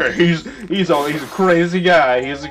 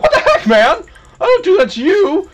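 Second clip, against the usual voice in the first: much higher, louder, a wounded cartoon voice; life as a person; 6 dB louder than usual. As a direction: play life as an offended buddy. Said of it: "and life will be like".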